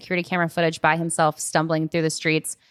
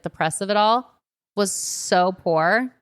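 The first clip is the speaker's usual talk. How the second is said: ordinary talk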